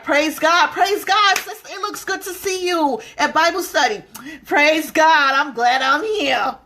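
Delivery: high, loud, forced churchy praise; fake church cheer. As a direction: gush with fake piety at Bible study. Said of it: "looking all nasty".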